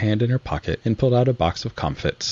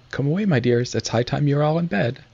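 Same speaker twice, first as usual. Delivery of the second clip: higher, lightly voiced anxious little bird mother; a fretful canary hurrying her chicks to bed, higher; mild mock tremble in a higher voice; trembling canary voice; 8 semitones higher than usual. A narrator's reading. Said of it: "a canary came out in a trembling voice".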